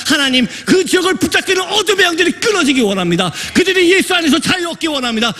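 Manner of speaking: high, driving preacher's appeal; impassioned exhortation. Real, not acted. Preacher urges congregation to pray for missionaries, impassioned exhortation.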